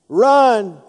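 loud, high, one-word burst; urgent shout. Pretend. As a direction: shout it like a sudden command to flee. Punchline definition of flee, called out.

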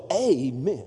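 a soft, low amen, shown to the congregation; quiet example amen. Shown as a demonstration. Pastor showing the church how to answer, quiet amen. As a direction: murmur amen under your breath. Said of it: "I would have said".

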